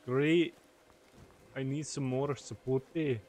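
slow, badly faked Scottish accent; attempted Scottish accent. Gamer attempting a Scot asking for fruit, failed Scottish accent. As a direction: try Scottish, drawing the words out, landing nearer Spanish. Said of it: "how does a Scottish accent sound?".

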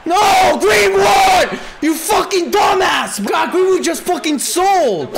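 furious yelling at player; angry shout. Real, not acted. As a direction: yell in real frustration. Furious at teammate's miss.

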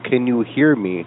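ordinary talk

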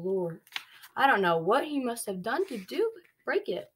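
plain reading voice

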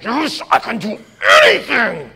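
rising, eager shout of faith; imitating a brand-new believer on fire; fired-up confidence. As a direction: sell it like nothing can stop you.

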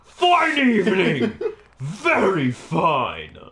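slow loud posh cry; mock war cry.

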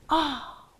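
ordinary delivery

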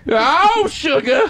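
high, exaggerated sassy caricature; put-on high voice, hammed up. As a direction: call it out in a high mock-female voice.

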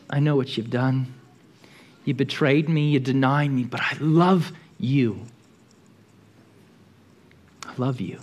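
forgive him gently with each word; tender forgiveness. Acted. Jesus forgives Peter.

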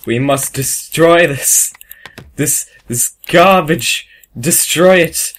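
slow, loud mock-fanatical decree; hammed-up inspector. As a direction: pronounce it slowly and forcefully, like a zealous official. Inspector rants at a foreign passport.

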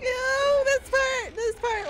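loud, high hyped call; excited. Real, not acted. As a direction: build hype for viewers. Hyped about the next part.

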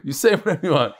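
ordinary talk, nothing marked

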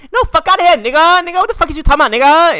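high, loud, in-your-face mock yell; aggressive New York girl. Florida guy mimicking New York girls, high loud mock yell. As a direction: snap back hard like a hothead New Yorker. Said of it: "They be like".

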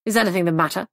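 mildly sharp question. A narrator's reading. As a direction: ask with a slight edge. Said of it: "Then he added sharply".

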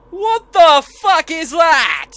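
slow, loud, high startled shout; scared horror game streamer. Real, not acted. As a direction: recoil from the monster. Monster appears in game.

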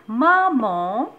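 loud, slow and clear, word by word; slow sentence reading. Shown as a demonstration. Teacher reading a simple sentence aloud for mothers, slow and loud. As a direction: read it out slowly for the little one. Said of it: "باش نتعلمو نقراو حتى اون فراز".

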